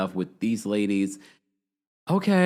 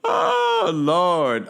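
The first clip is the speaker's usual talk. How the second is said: amused exclamation; 7 semitones higher, 4 dB louder than usual. Real, not acted. Laughing exclamation at the show.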